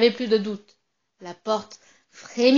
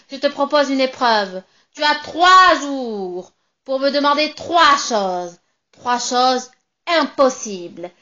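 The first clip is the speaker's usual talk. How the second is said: witch's challenge; 6 dB louder than usual. Acted. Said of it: "La sorcière me répond".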